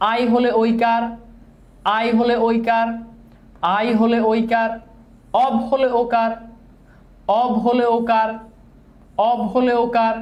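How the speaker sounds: level, measured rule chanting with pauses between repeats; repeat-after-me drill. Shown as a demonstration. Teacher leading students who repeat the vowel rules, chanted drill. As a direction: recite it evenly for a class to echo. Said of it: "আমার সাথে সাথে বলেন".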